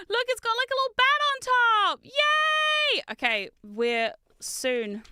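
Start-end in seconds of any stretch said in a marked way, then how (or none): 0.1-3.0 much higher, rising to a loud cheer of yay; delighted squeal. Real, not acted. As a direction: gush over the tiny bat, then cheer. Delighted by the little bat.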